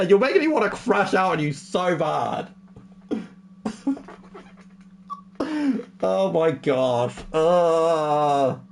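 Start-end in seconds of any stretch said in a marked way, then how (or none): none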